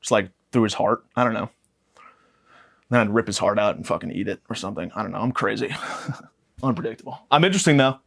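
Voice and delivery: normal talking voice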